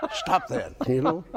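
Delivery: very high real laugh through the words. Real, not acted. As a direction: giggle out the plea. Hushing wife while laughing.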